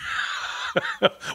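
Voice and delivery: breathy high burst of laughter; laughing. Real, not acted. Laughs at Canadian joke.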